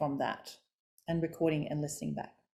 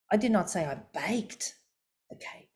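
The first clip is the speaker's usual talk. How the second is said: "baked" raised and held, then a pause; stress on BAKED. Shown as a demonstration. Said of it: "you can emphasize words".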